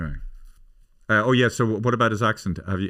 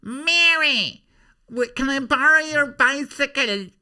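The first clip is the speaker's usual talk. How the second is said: shrieking falsetto, loud and very high; comic character calling out; 7 dB louder, slower than usual. Acted. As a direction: holler for a neighbour in a squeaky voice. Character calls out to Mary.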